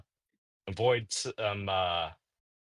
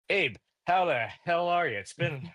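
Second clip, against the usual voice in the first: higher, lively acted hello; hearty greeting; 8 semitones higher than usual. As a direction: greet a long-unseen relative heartily.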